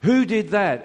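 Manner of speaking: loud, high demand from the parade ground; British sergeant major. Retold or imitated. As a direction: call out the class like a sergeant. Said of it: "every teacher talks like a British sergeant major".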